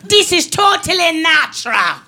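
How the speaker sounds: sassy Jamaican woman, slowed and defiant; Jamaican attitude. Retold or imitated. Cousin snapping at staring people, mock Jamaican attitude. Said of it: "She's Jamaican, and they get attitudes".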